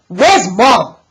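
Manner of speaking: loud, sharp demand. Acted. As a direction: insist on an answer now.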